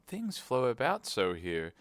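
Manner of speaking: slow plaintive complaint. A narrator's reading. Alice chasing shelves, plaintive. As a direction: sigh it out plaintively. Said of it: "in a plaintive tone".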